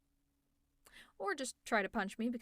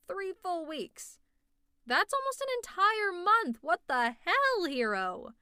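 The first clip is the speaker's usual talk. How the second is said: high, loud, drawn-out disbelief; building from stunned to yelling; exasperated scolding, voice raised; appalled outburst; 7 semitones higher, 8 dB louder, slower than usual. Acted. Appalled outburst at hero's sleeplessness.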